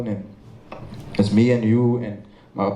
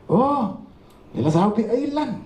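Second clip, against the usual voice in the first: a higher, cheery Cape Flats greeting; imitating a Cape Flats hello, pitched up; light Cape Flats accent, higher; 11 semitones higher than usual. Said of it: "Benny still have the accent".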